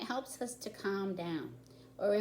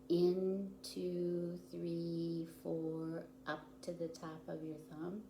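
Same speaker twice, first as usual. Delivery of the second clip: guided breathing count; 12 dB quieter than usual. Shown as a demonstration. Teacher counting children through starfish breathing, softly. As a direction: lead the children's breaths gently. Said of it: "So when you breathe in".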